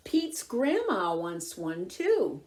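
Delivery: plain reading voice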